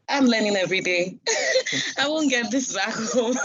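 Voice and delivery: laughing through a retold reaction; amused at your own surprise. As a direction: break into laughs between the phrases. Laughing at own culture shock.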